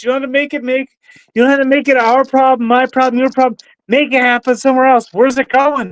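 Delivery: exasperated rant. Real, not acted. Ranting at a chat member.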